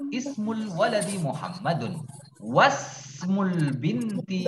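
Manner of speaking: "Ismul waladi" high and clear, the words run together; linked Arabic reading demo. Shown as a demonstration. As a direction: model "Wasmul binti" as one joined phrase, first syllable drawn out.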